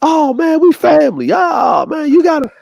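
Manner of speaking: high whining excuse, one shout; whiny cousin. Retold or imitated. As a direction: whine it up high to dodge paying.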